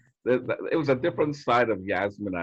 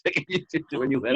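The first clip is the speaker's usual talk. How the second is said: laughing; 12 semitones higher, 4 dB louder than usual. Real, not acted. Laughing while recalling the nightclub.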